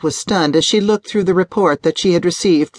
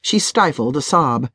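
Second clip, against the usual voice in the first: ordinary delivery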